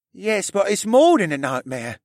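put-on high boyish character voice, mildly cross; scowling. A narrator's reading. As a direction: answer with a scowl, pitched high. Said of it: "answered with a scowl".

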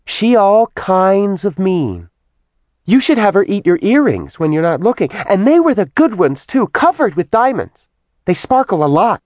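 put-on high, chattering little girl voice; childlike demon voice. A narrator's reading. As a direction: tattle on her like a child. Simi character voice.

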